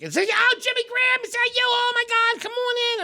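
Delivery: very high, excited imitation of a lady; mock-thrilled, high-pitched hostess voice; gushing old lady greeting. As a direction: squeal a hammed-up welcome. Lady greets the arriving comedian.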